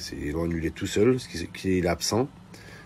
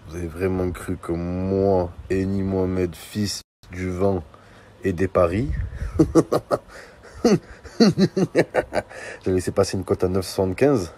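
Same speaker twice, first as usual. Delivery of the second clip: normal talking voice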